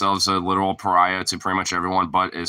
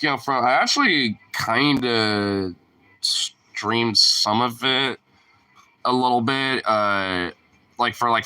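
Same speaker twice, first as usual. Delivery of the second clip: ordinary delivery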